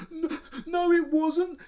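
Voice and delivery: high, loud wailing denial; sobbing denial. A narrator's reading. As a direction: wail it through tears. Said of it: "he sobbed".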